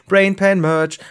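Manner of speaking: loud, punchy slogan call; slogan shout. Pretend. Werbeslogan, laut gerufen. (laut ausgerufener Werbeslogan)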